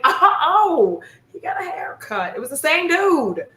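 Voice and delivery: high-pitched oh of recognition; acted-out penny drop, voice shooting up; dawning realization. Acts out sudden realization.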